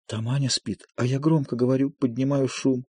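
hushed so as not to wake Anya. A narrator's reading. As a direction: keep it down, someone is asleep. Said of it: "Тише".

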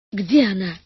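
breathless, panting question; out of breath. A narrator's reading. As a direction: gasp it out after the swim. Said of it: "спросила, тяжело дыша". (запыхавшись)

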